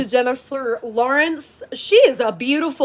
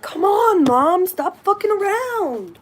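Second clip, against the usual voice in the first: dog voice; 6 semitones higher than usual. Retold or imitated. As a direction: voice the dog nagging Mom to go out. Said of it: "He'll be like".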